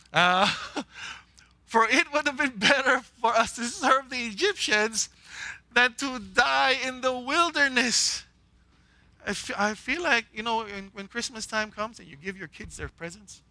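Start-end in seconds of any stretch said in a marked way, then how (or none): 1.7-8.2 angry. Acted. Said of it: "I'm getting angry again".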